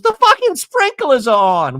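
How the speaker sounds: high, loud mock yell; retold exasperated outburst. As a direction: imitate someone storming off annoyed. Acted outburst walking off.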